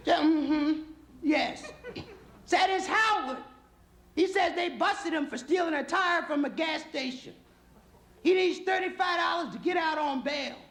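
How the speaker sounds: high falsetto wife passing on a message; comic woman's voice, very high; wife on the phone. Acted. Wife relays the phone call.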